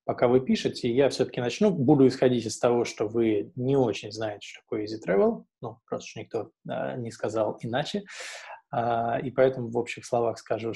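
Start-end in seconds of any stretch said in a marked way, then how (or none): none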